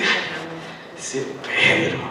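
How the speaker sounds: soft, slow, half-breathed fond scolding; fond sigh. Retold or imitated. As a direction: shake your head at Pedro tenderly. Jesus voiced fondly.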